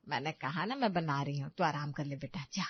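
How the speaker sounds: low, gently insistent voice; soothing mother-in-law. Acted. Mother-in-law telling bahu to rest, gently insistent. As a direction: send her off to rest, low and kindly.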